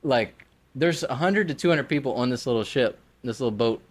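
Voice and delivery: ordinary delivery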